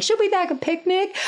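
very high, chirpy mom voice; sing-out perky mimicry of a keen mom; imitated amazing mom. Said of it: "another mom who's being amazing is like".

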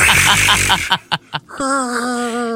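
loud, high dinosaur roar; T-Rex roar. Pretend. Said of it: "That was my impression of a T-Rex".

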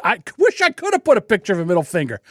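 ordinary talk, nothing marked

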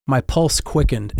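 ordinary delivery, nothing marked

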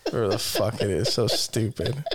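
ordinary talk

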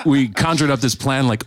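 usual speaking voice

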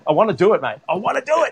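can't wait to get started; excited, voice climbing. Real, not acted. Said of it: "I'm excited".

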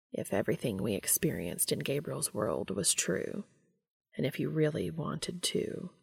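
low, soft, tentative voice; trailing off. A narrator's reading. Cass tentatively asking Dean what he wants, trailing off. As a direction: leave the sentence hanging, hushed and low. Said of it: "He trailed off".

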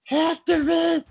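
high, strained shout through a shot throat; strangled shout. Retold or imitated. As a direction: yell hoarsely, very high, dragging the last words. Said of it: "and he goes".

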